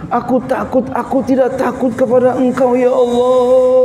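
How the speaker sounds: high pleading wail, stretched at the end; imitated tearful cry. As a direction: act out a man crying out to God. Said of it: "Nangis saja dia". (menirukan orang berdoa sambil menangis keras)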